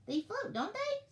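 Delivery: very high, slow penguin voice; penguin excuse. A narrator's reading. Penguin dodging the ornament job, high-pitched. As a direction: draw it out way up high.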